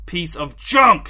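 slow, loud angry shout; frustrated yell at the car. Acted. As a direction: bellow it at the broken car. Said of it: "shouted the man".

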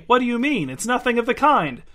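high, indignant protest; indignant denial. A narrator's reading. Fedya's silent protest voiced.

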